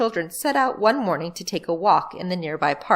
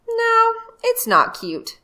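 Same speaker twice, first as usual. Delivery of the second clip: put-on higher, slow, insistent boy; a brother flatly refusing; emphatic boy; 4 dB louder, slower than usual. Barnaby A voiced, emphatic.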